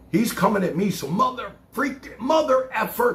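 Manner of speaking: riled up, pitching up; angry about the son coming at him; worked-up. Real, not acted. Heated retelling of son's attack.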